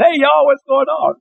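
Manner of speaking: high Southern holler at normal volume; Southern accent. Retold or imitated. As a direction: lay on a Southern accent in greeting. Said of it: "really throw on the Southern accent".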